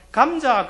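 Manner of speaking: 감자 said plainly, the pastor's own way; saying gamja. Retold or imitated. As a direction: say 감자 in a clear standard way. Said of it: "제가".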